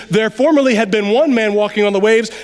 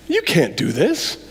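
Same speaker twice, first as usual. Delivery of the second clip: low, slow voice of doubt; slow, lowered, mocking inner doubt; 5 semitones lower, slower than usual. Peter's mind talking to him on the waves, low doubting voice. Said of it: "Peter's mind starts to say things like".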